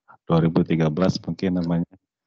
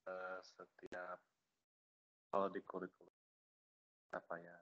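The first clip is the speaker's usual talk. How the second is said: faint voice far from the mic; almost inaudible, distant speaker; barely audible; 24 dB quieter than usual. Real, not acted. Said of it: "suaranya terlalu kecil banget".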